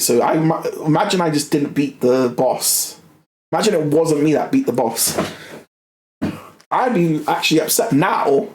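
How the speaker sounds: normal talking voice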